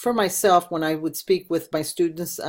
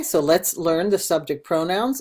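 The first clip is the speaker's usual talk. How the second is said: ordinary talk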